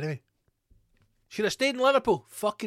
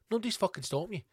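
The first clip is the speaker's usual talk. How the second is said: ordinary talk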